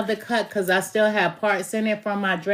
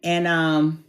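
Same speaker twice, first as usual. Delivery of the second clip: ordinary talk, nothing marked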